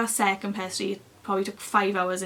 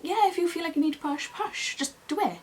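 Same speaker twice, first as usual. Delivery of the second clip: high, insistent go-ahead; urgent encouragement; 8 semitones higher than usual. Retold or imitated. Midwives telling patient to push, urgent encouragement. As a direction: urge them on in a raised pitch.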